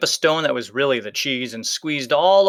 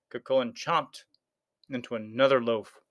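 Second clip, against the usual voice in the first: ordinary delivery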